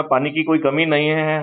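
ordinary talk

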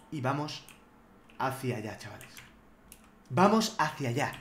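low murmur while sneaking; hushed stealth. Real, not acted. Gamer sneaking up on an enemy, hushed murmur. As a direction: keep it hushed so the enemy won't hear.

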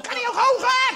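put-on high, loud old-lady call; old grandma calling out.

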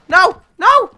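short, loud, high protest; frustrated yell. Real, not acted. Gamer failing a knife kill, yelling in frustration.

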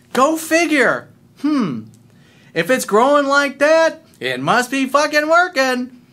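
high, slow mock-amazed exclaiming; sarcastic boast. Real, not acted. Coach boasting back at a critic, sarcastic. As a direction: rub it in, high and drawn out.

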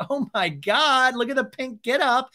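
high, loud, drawn-out admiration; mock excited squeal; gushing. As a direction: gush over an outfit. Acted Lala gushing at outfit.

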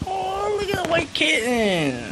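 very high, drawn-out coo; squeal-coo at an adorable kitten; gushing over kitten. Real, not acted.

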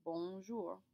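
bonjour said as one smooth word, nasal bon; French bonjour. Shown as a demonstration. Teacher showing class the joined word bonjour. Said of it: "smash them together into one word".

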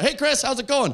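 high, lightly put-on hello; mock friendly greeting. J J greeting comic in alley, high mock-friendly hello. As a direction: chirp it as an imitated acquaintance.

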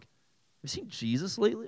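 high, soft, mock-casual question; puzzled Trinity member. The Trinity sitting around wondering, high puzzled voice. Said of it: "they're like".

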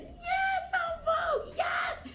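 high, loud retold fan cheer; excited cheer. Fan recalling En Vogue's appearance, high excited cheer. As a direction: cheer them on, high and thrilled.

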